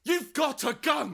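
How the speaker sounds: high, slow desperate shout. Acted. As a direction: drag each word out, yelling. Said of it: "Stop shouting!".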